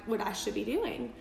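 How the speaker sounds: normal talking voice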